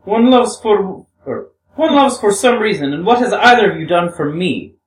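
a hint of a young woman, earnest and pleading; lightly voiced Grushenka. Grushenka voice again.

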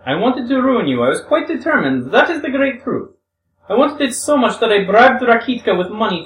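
a hint of a young woman, earnest and pleading; lightly voiced Grushenka. Grushenka voice again.

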